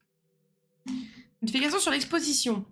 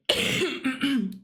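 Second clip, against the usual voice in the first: dry cough. Real, not acted. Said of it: "je tousse encore un peu".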